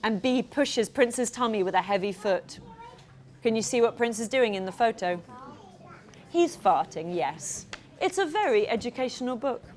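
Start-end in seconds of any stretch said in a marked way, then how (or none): none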